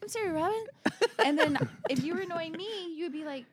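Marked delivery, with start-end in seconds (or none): 0.0-0.6 high, sheepish sorry; meek apology. Retold or imitated. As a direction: apologize like a scolded kid. Meek apology voiced in a story.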